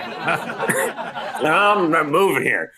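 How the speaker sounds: slow, laughing words as he says it; half laughing at his own stubbornness; laughing through it. Retold or imitated. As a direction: chuckle out the line and let it stretch. Retelling his stubborn late-night refusal.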